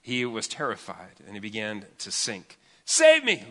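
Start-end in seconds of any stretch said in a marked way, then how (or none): 2.8-3.4 high, loud cry for help; shouted plea. Acted. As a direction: cry out, sinking. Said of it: "he shouted".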